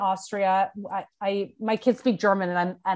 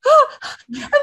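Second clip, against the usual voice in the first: high, loud astonished gasp; sharp gasp; 6 dB louder than usual. Retold or imitated. Host reliving the text from Brooke, gasping high. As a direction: gasp as if the breath is knocked out. Hearing Brooke loved the book.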